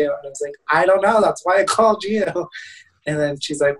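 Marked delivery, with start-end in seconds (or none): 0.7-2.2 a bit fed up, pitched up; exasperated. Retold or imitated. To his mom asking what to do, mildly exasperated and higher.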